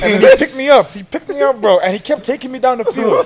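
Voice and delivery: high-pitched, incredulous recounting with laughter; excited, amused storytelling. Real, not acted. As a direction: tell it amazed and tickled. Laughing at his own story.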